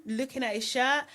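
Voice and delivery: usual speaking voice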